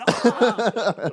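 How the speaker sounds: sudden high loud shriek; startled scream. Retold or imitated. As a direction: yelp in mock shock. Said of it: "I'm like".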